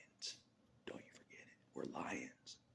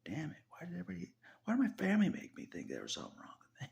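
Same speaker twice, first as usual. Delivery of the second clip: rising, louder, faster protest; exasperated outburst; 11 semitones higher, 6 dB louder, faster than usual. Retold or imitated. Venting to a friend on the phone, exasperated high outburst. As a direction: blurt out the frustration, climbing higher. Retelling frustrated call to friend.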